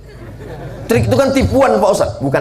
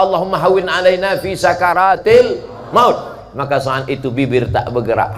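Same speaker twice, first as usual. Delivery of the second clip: usual speaking voice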